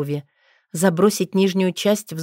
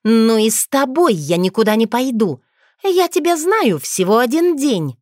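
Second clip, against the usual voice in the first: louder, much higher yelling; indignant shout; 5 semitones higher, 5 dB louder than usual. Acted. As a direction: shout her outrage at him. Witch shouting at werewolf.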